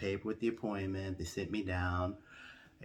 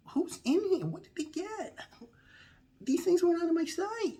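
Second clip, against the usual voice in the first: high, frantic self in a panic; panicked high voice. Retold or imitated. Telling the audience how he freaked out, high and frantic. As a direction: squeak it out like you just saw a stranger.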